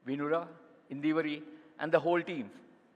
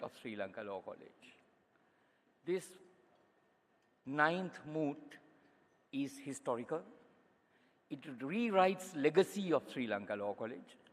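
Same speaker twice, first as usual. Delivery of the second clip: Sri Lankan accent; 3 semitones lower, 4 dB quieter than usual. Real, not acted.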